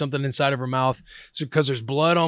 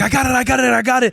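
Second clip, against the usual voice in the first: high-pitched, repeated in a rush of alarm; frantic urgency; 10 semitones higher, faster than usual. Retold or imitated. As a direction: imitate a frantic rescuer reaching for it. Mother's frantic rescue retold.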